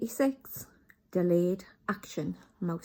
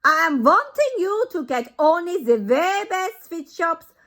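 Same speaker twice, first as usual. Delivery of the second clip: put-on much higher, loud accented demand; Grand High Witch; 10 semitones higher, 12 dB louder than usual. Grand High Witch demands the best shops, loud accented. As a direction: insist grandly.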